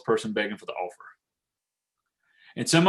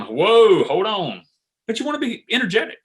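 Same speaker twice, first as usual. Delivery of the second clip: mock startled "whoa", higher and louder; overhyped enthusiasm; 9 semitones higher, 4 dB louder than usual. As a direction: act out being hit by too much hype. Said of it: "overinflated hype enthusiasm".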